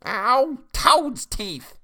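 high, loud jaded snap; high witch snap; crone snapping. A narrator's reading. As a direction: snap it like a sour old witch. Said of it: "snapped the crone jadedly".

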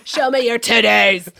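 loud, aggressive joking shout; mock-aggressive yell. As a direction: blurt it out at full volume, half laughing. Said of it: "Yeah, it was very aggressive".

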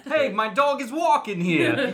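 high, slow mock tough-local accent; hammed-up "walking here" street accent; dog-walking accent. Co-host teasing her dog walking accent, mock accent. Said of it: "Dog walking accent".

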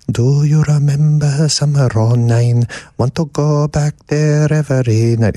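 put-on sung in a thick Swedish accent, high and slow. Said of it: "he's got this Swedish accent, you know, very thick".

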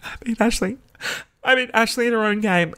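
high, mock-tearful amazement; near tears. Student about to cry after beating the rival, mock near tears. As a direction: act choked up with victory, lightly. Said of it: "I was gonna cry".

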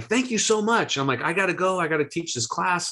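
high, breathless rush of thanks; bubbling over as he heads out the door; giddy thanks. Retold or imitated. Thanking Danusha and rushing off to teach, giddy and high.

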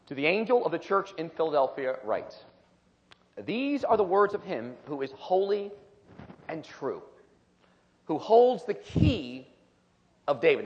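slow, solemn reading of scripture. Acted. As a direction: read each phrase deliberately, with weight. Reads scripture aloud.

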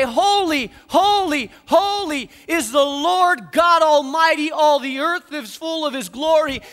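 ringing, awestruck praise; loud angelic proclamation. Retold or imitated. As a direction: call it out like a choir of angels. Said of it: "would echo back to them and say".

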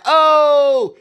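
loud, high, drawn-out oh; sudden realization. Retold or imitated. Host retelling watching the show, loud dawning realization. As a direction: let the penny drop out loud.

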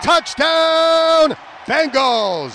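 high, loud, long-held "touchdown"; excited touchdown shout. Real, not acted. Announcer announcing a Bengals touchdown, excited shout. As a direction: belt it out and stretch the word.

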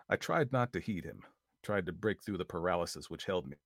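plain reading voice

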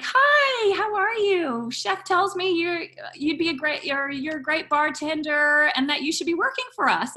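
high-pitched gushing phone greeting; imitating an effusive woman recruiter. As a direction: greet a stranger sing-song and high. Voicing Helen on the phone.

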